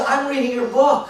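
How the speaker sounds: imitated high-pitched fan gush; excited. Woman to the author she reads, excited. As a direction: play her thrilled at meeting the author.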